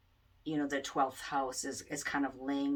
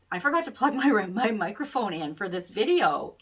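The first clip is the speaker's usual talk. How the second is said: rising, louder flustered admission; higher, louder, caught-out confession; sheepish realization; 4 semitones higher, 8 dB louder than usual. Real, not acted. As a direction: blurt out the embarrassing mistake. Realizing she forgot the mic.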